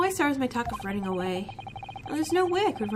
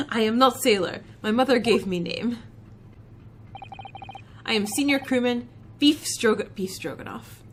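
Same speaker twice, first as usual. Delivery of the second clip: low, loud gruff Russian sailor voice; a proud Russian crewman, heavy accent; Russian seaman introducing himself, low and gruff; Stroganoff, Russian accent; 4 semitones lower, 3 dB louder than usual. Acted.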